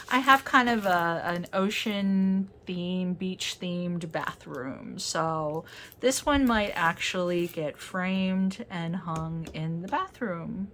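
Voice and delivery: normal talking voice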